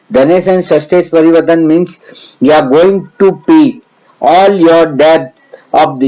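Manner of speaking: normal talking voice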